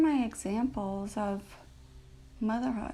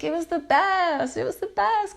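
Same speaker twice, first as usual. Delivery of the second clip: high, bright burst of joy, louder; gushing delight; 9 semitones higher, 7 dB louder than usual. Real, not acted. Remembering her grandma, she gushes with joy. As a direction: rave about her with real delight.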